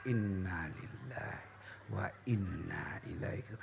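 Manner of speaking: slow whispered prayer; whispered recitation. Shown as a demonstration. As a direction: breathe the verse out in a whisper. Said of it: "balik kanan".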